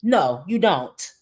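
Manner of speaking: normal talking voice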